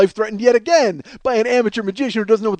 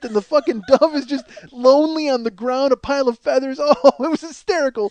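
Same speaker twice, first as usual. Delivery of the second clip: ordinary talk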